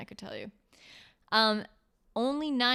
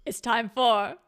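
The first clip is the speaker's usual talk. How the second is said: slow, drawn-out show announcement; announcer voice; 4 dB louder, slower than usual. Acted. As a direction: announce it like a game show host. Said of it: "segment jingle announcement".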